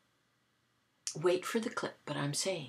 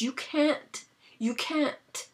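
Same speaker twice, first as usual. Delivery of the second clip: retold protest; 7 semitones higher, 6 dB louder, much slower than usual. Caller protests being distracted.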